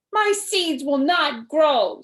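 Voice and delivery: upset. A narrator's reading. Toad upset at seeds.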